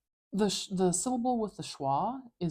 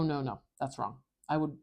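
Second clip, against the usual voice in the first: ordinary delivery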